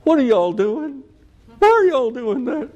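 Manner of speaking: high, put-on whine; whiny complaining. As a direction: whine it like a helpless, nagging parent. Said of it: "but he sure complained".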